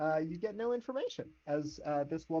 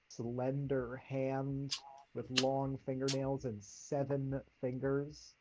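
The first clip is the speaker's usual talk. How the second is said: usual speaking voice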